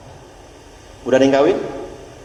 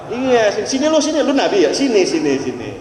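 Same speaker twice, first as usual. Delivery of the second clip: a high, eager call; mock summons; 5 semitones higher than usual. Beckoning a false prophet over, high and eager. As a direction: wave him over, half joking.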